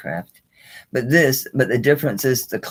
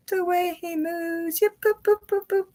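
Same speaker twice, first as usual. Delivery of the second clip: playful toy voice. Pretend. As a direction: coo over the little turtle in a squeaky high voice. Showing the turtle's moves.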